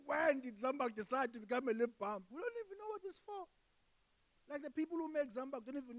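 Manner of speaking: exasperated question climbing very high; mock bafflement. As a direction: protest in comic disbelief, pitch shooting up. Acted baffled protest about the product.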